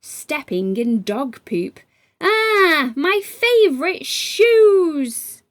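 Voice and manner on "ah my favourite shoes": high, slow, put-out cry over ruined shoes; dismayed wail.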